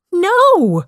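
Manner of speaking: high-pitched shouted protest; indignant high shout. A narrator's reading. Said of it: "Joe shouted".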